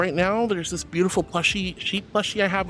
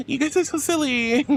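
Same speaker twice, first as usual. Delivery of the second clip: laughing; 10 semitones higher, much slower than usual. Real, not acted. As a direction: giggle helplessly while talking. Laughing hard at boops.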